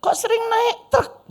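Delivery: high, slow, curious neighbour voice; nosy neighbour. Retold or imitated. Neighbour questioning his mother about the truck trips: high, slow nosy voice. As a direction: ask it like a prying neighbour. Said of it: "tetangga bilang".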